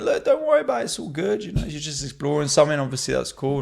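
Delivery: high, loud sing-song reassurance; high cheerful friend voice; mock reassuring friends. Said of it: "they went".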